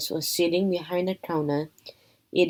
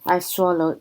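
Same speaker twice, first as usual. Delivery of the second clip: ordinary delivery, nothing marked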